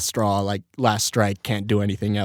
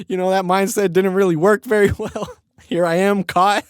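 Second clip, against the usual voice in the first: higher voice breaking into laughter; laughing mock panic; 8 semitones higher than usual. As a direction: retell your freak-out, cracking up. Retells his panic while laughing.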